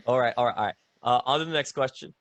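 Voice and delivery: usual speaking voice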